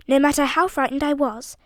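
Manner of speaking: plain reading voice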